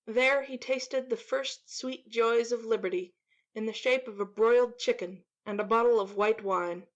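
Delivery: ordinary delivery, nothing marked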